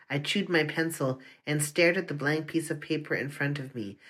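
ordinary delivery, nothing marked

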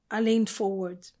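plain reading voice

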